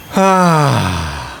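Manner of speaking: long audible sigh sliding downward; releasing sigh. Shown as a demonstration. Voice coach exhaling to calm the nervous system, long sigh. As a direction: let a long calming sigh fall away. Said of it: "allowing the sounds".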